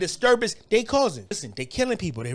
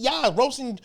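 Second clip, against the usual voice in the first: ordinary talk, nothing marked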